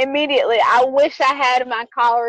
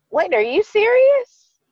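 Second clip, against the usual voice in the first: mock disbelief; 9 semitones higher, slower than usual. Disbelief at date's reply.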